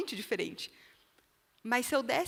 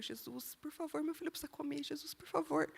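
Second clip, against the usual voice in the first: soft, high, pleading prayer through tears; tearful prayer; 5 semitones higher, 11 dB quieter than usual. Retold or imitated. Mother praying for her son who won't eat, soft tearful plea. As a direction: beg Jesus quietly, close to crying. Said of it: "eu tava chorando".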